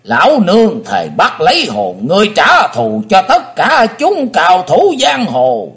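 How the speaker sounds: grind out a vengeful oath; teeth-gnashing rage. A narrator's reading. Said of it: "nghiến răng trèo trèo".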